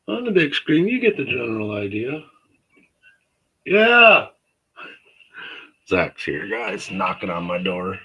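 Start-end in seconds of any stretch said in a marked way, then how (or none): 3.6-4.3 yelling across the room. Real, not acted. Answering a knock from upstairs.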